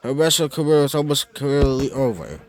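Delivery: ordinary talk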